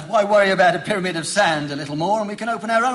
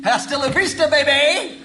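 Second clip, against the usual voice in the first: high, laughing Terminator send-off; playful mock action-hero goodbye; film catchphrase; 7 semitones higher than usual. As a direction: toss off the movie line cheekily. Quoting Terminator on leaving.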